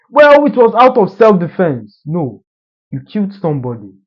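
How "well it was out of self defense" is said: a louder, higher defensive protest; defensive retort. Retold or imitated. Conscience arguing back, loud and defensive. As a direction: argue back loudly, justifying yourself.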